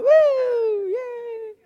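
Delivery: very high-pitched mock child's whoop; long, high imitated cheer; gleeful kid cheering. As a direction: squeal like a delighted kid on a ride.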